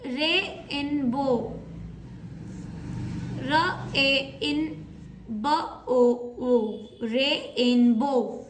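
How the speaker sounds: "Rainbow" drawn out very slowly, letter by letter; धीरे-धीरे rainbow; slow spelling out. Shown as a demonstration. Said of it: "for example".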